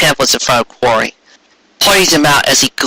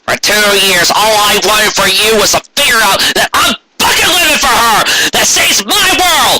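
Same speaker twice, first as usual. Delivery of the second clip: furious rant; 7 semitones higher than usual. Acted. Carrot's furious rant at Quarry.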